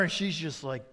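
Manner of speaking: ordinary talk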